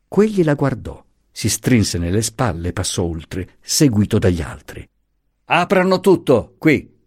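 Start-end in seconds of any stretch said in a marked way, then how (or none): 5.5-7.0 higher, rough command; loud and rough. A narrator's reading. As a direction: bark out the order to open everything. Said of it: "diss'egli forte e ruvidamente".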